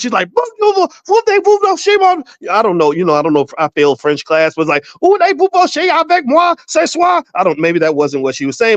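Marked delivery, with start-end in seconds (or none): 0.4-2.2 very high-pitched imitation of screaming in French; mock French yelling. Retelling a lady yelling at petition girls in Paris, mock screaming. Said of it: "she starts yelling and screaming in French". 5.0-7.2 high-pitched, badly faked French tirade; mock French yelling. Imitating the French lady at the other girls, mock yelling. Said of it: "but she was yelling at the other girls".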